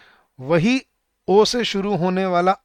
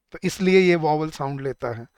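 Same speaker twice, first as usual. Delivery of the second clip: ordinary delivery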